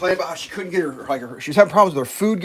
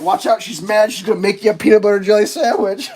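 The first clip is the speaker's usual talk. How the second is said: loud, raised teasing alarm; mock warning; 4 semitones higher, 6 dB louder than usual. Joker warns the group the girl is mad, mock alarm. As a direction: warn everyone, about to crack up.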